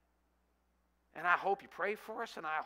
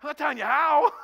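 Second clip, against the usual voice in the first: laughing shout; 10 semitones higher, 13 dB louder than usual. Retold or imitated. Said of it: "And I said to him".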